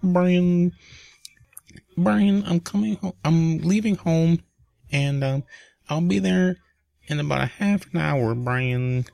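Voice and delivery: high sing-song call of a name; Carly's voice. Retold or imitated. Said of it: "That's how she says my name".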